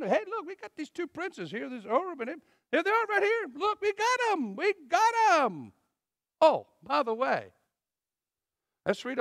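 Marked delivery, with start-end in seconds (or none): 0.0-5.7 mock-boastful men, pitched higher and higher; boasting Ephraimites. Ephraim's men showing Gideon their captives, mock boasting. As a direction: show off the two princes like a trophy.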